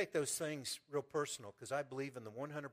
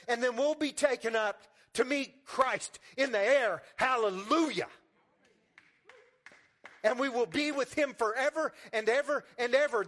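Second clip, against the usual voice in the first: loud, high, soaring preacher voice; full-force preaching; 9 semitones higher, 8 dB louder than usual. Acted. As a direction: lift the church with a rising, ringing proclamation.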